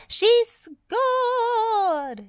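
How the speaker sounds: very high, loud triumphant shout; victorious squeal. A narrator's reading. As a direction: yell the goal with joy. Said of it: "shouted the little cement mixer".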